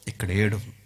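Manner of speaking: ordinary talk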